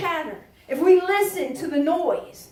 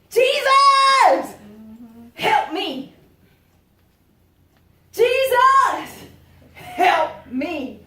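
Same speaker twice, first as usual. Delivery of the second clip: high, loud cry of Jesus! then Help me; shouted cry; 5 semitones higher, 5 dB louder, much slower than usual. Shown as a demonstration. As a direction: yell it out to Jesus. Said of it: "I've been yelling that all night".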